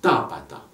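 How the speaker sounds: TApata, weight on the first syllable; stress on first syllable. Shown as a demonstration. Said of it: "Accent sur la troisième avant la fin".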